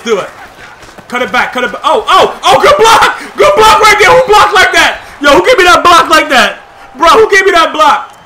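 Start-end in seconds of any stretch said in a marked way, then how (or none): 1.8-8.1 much higher, much louder cheers with a laugh; excited cheering. Real, not acted. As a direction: hype up the block. Celebrating a great block.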